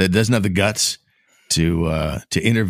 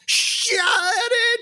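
mock shriek. Real, not acted. Cutting off the mock mother.

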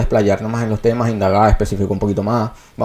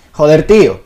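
higher, louder Venezuelan lilt; Venezuelan accent; 5 semitones higher, 5 dB louder, slower than usual. Shown as a demonstration. Showing friends how Venezuelans would say it, Venezuelan accent. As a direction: put a sing-song Venezuelan accent on it. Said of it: "nosotros podríamos decir".

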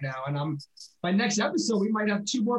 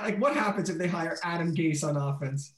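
normal talking voice

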